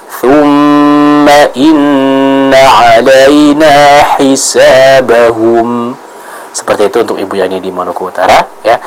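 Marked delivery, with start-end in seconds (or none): 0.1-6.0 slow melodic tilawah; Quran recitation. Shown as a demonstration. Teacher models the verse in full recitation. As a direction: chant it slowly and evenly.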